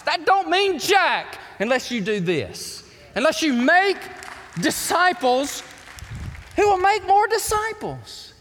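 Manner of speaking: high, loud preacher's cry; fiery pulpit preaching, loud and high; preaching at full force. Acted. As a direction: preach it out and rally the room. Pastor preaching to congregation.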